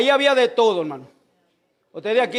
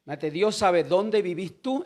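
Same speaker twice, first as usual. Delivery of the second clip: usual speaking voice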